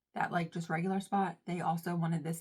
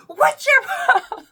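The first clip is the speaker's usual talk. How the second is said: high, drawn-out confrontational yell; comically over-the-top angry shriek; going off; 12 dB louder, slower than usual. Retold or imitated. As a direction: yell it far above your normal pitch. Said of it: "I go off".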